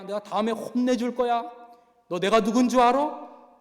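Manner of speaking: loud and high, a menacing inmate lecturing; retold killer loudly warning a young inmate to believe; angry threats. Go Jae-bong threatens the inmate.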